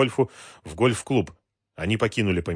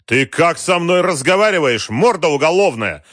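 loud, high, affronted bellow; outraged yelling; 9 semitones higher, 8 dB louder than usual. A narrator's reading. General roaring back at the gangster, outraged. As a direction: yell back, deeply affronted. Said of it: "заорал в ответ генерал".

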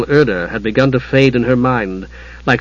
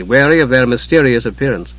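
usual speaking voice

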